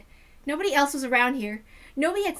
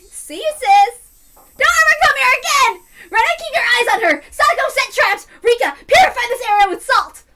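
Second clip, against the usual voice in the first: loud high shouting; yelling orders; 9 semitones higher, 13 dB louder than usual. Acted. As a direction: bark commands at the whole club. Sister chasing Shion off, yelling.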